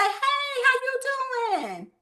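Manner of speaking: very high, drawn-out singsong greeting; hammed-up sugary phone hello, stretched way up high; mock friendly caller. Trainer mocking how her consultants open calls, high and stretched. Mimicking callers she warns against.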